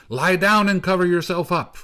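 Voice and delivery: high, loud urgent order; shouting. A narrator's reading. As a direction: shout over the storm. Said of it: "shouted the snow boy".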